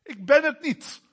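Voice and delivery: high, loud denial as God; God denying. Acted. Preacher voicing God against golden calves, loud and high. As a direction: fling it out as God.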